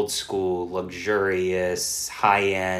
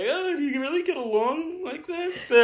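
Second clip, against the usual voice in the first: mock listener voice. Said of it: "I know some of you ask".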